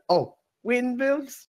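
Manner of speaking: high, slow mock Dutch accent. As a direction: drag it out like a Dutch cliché. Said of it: "Dutch accent".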